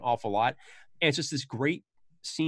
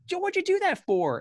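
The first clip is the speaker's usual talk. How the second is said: much higher, startled protest; shocked Jimmy. Retold or imitated. Jimmy reacting to the shooting, startled and high. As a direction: cry it out in disbelief. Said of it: "Jimmy's immediately like".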